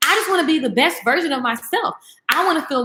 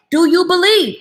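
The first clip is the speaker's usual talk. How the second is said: louder, higher Jesus asking the crowd; Jesus calling out a question to the crowd; voicing Jesus; 6 semitones higher, 5 dB louder than usual. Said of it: "guess what he said".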